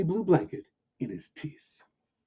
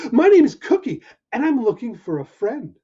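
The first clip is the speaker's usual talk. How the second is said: higher, eager little puppy; bright hopeful pup saying hello; 7 semitones higher, 4 dB louder than usual. Acted. Puppy introducing himself to the lion, eager and high.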